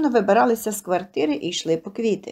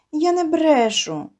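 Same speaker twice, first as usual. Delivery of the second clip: higher, slow, mild tearful protest; sobbing protest; 6 semitones higher, slower than usual. A narrator's reading. Little girl denying she lies to Katerina, mildly tearful and slow. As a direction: hold back tears as you deny it. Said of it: "схлипнула дівчинка".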